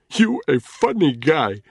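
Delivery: put-on slow, loud, scornful Nigerian accent; spat insult. Ntoga mocking Danny's threat, scornful spat words. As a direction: spit the words out. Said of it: "spitting the words out insultingly".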